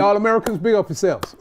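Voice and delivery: a lightly put-on, terrible American accent; hammed-up American shout out to fans; bad American accent. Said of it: "I know mine's terrible as well".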